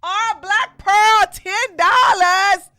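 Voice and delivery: loud, high, stretched announcement; donor shout-out. Real, not acted. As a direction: call it out slowly like a big prize.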